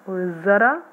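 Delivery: usual speaking voice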